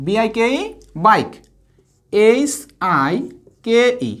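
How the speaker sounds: Bike then বিআইকেই spelled out letter by letter, slowly; slow classroom drill; slow teaching model. Shown as a demonstration. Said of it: "কি উচ্চারণ করব?".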